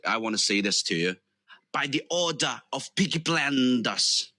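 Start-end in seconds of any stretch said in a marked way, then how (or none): 1.7-4.3 amateur Brummie gangster line; Birmingham Peaky Blinders impression. Shown as a demonstration. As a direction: declare it in a put-on Birmingham accent. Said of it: "since you're from Birmingham, I, I wanna say".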